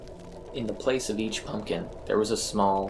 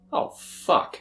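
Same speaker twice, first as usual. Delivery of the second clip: sharp, higher burst of alarm; shocked curse; 9 semitones higher, 4 dB louder than usual. A narrator's reading. Narrator seeing the children return, startled curse. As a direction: blurt it out in fright. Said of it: "I said".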